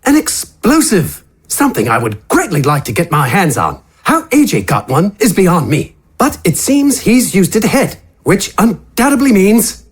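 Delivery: relishing the idea of explosives; Wither's voice. Acted.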